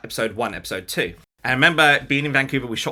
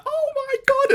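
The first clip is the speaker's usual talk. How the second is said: much higher, excited Oh my God; awed squeal; 4 dB louder, slower than usual. Retold or imitated. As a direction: gasp it out at the amazing shot. Watching dailies in awe.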